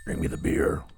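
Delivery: voiceless whisper of Bring me the beer; whispered skull. Shown as a demonstration. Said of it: "Tucky Skulls never lie".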